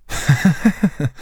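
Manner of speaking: short mocking snicker; sneering laugh. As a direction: snicker to himself. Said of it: "ricana Lupin".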